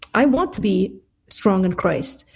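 ordinary delivery

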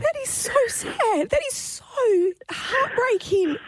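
high-pitched, tearful sympathy; heartbroken, choked up. Real, not acted. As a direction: let the story get to you, voice rising. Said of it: "Still gets me. It got me again".

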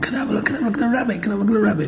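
put-on high, eager children begging; eager kids. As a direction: plead like a crowd of excited kids. Kids begging to see carving.

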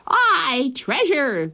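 high excited crew cry; crew shout. A narrator's reading. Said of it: "the others shouted".

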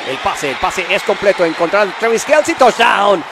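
rising to a high, loud touchdown shout; touchdown call. Real, not acted. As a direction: scream the touchdown, stretch the ending. Live touchdown call on broadcast.

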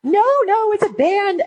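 high, wailing schoolgirl voice; teen fan crying to her teacher, high and wailing; sobbing teen girl. Retold or imitated. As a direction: mimic a heartbroken fourteen-year-old, way up high. Girls correcting the teacher.

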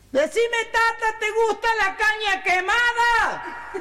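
high, slow, sustained holler; boy's distant shout. Acted. Son asking his Tata from afar, slow high country holler. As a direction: shout each word so it carries over the fields. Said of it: "le dice el hijito de nuevo".

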